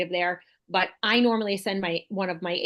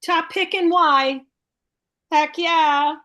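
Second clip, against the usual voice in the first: higher, louder, slow triumphant read; excited cheer; 7 semitones higher, 7 dB louder, slower than usual. Real, not acted. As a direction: celebrate it out loud. Celebrating the result.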